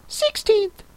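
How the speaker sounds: high squeaky dormouse voice; tiny squeaking creature; sleepy snoring dormouse. Acted. Dormouse answers in court.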